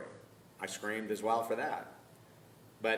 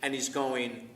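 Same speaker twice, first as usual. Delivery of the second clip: ordinary talk, nothing marked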